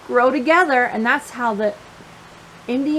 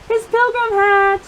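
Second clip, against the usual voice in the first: high, bright-eyed announcement of the hat; delighted ta-da; excited reveal; 7 semitones higher than usual. A narrator's reading. Excited reveal to young listeners.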